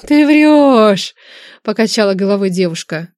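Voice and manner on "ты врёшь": higher, louder disbelieving cry; shocked denial. A narrator's reading.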